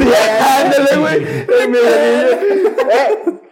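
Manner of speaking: high loud laughing shout. Real, not acted. Driver laughing at the Coco joke, high and loud. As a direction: crack up while naming him.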